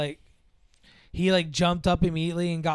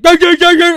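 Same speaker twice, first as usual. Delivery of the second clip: loud, high imitated barks; dog barking; 13 semitones higher, 18 dB louder than usual. Boyfriend dressed as dog greets parent, mock barking. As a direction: bark at them like an excited dog. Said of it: "he starts barking at him".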